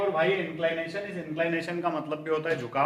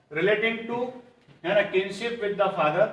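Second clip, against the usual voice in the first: धीमी dictation; much slower than usual. Shown as a demonstration. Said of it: "dictates the question slowly".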